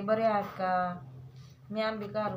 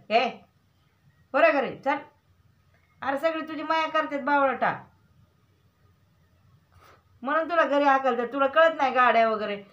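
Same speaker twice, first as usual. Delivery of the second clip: imitating an uncle's scolding, louder and a bit higher; a mock telling-off that turns fondly exasperated; 4 semitones higher, 6 dB louder than usual. Mother voicing the uncle who chased her son home, mock scolding. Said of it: "ती काका म्हणले असतील".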